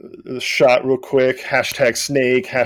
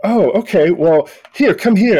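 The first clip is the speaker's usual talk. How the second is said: put-on higher, excited boy voice; a kid waving friends over; a high, eager schoolboy inviting them; 7 semitones higher, 3 dB louder than usual.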